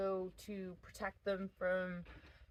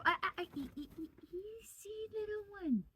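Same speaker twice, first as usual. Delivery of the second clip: soothing animal; 11 semitones higher, faster than usual. Real, not acted. Soothing a struggling rabbit.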